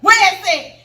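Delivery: loud, high imitation of a charging husband; battle-ready shout. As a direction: shout it, acting ready to attack. Husband charging out with machete, retold.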